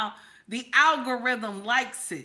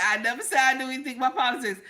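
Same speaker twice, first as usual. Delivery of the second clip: rushed; much faster than usual. Real, not acted. Rushed repeat.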